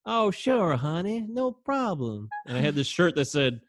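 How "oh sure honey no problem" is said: high, drawn-out Southern sweetness; sweet Southern stewardess. Retold or imitated. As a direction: imitate a honeyed Southern stewardess. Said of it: "She was, like, really sweet".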